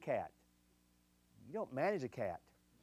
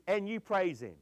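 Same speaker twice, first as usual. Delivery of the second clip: teasing list; 4 semitones higher than usual. Acted. Same list applied to husbands, comic.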